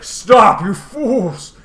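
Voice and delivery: loud, high hammed-up shout; shouted command. Ugin halting the warring dragons, hammed-up shout. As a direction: boom it out to halt the battle.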